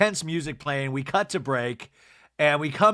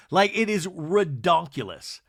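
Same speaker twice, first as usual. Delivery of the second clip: ordinary talk, nothing marked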